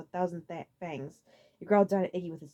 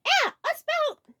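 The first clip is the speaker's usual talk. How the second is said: very high, loud alarm; alarmed cry; panicked; 6 dB louder, much slower than usual. A narrator's reading. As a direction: shriek in confusion. Lemmy losing first letters.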